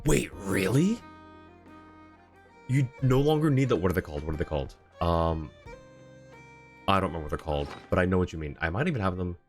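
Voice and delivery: ordinary talk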